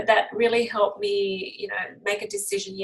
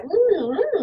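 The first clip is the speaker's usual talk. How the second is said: pleased hum; 10 semitones higher, 4 dB louder than usual. Retold or imitated. Boy sniffing the oils.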